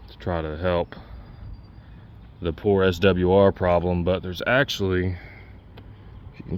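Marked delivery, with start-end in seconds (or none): none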